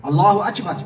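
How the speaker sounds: "اکبر" with ch in place of k, "achbar"; Persian-speaker pronunciation. Retold or imitated. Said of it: "کاف کو چین پڑھتے ہیں".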